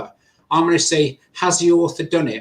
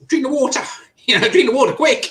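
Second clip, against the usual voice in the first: high, urgent, hurried plea; urgent urging; 9 semitones higher, faster than usual. Retold or imitated. Urging the choking mentor to drink quickly, high and urgent. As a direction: push him to drink it now.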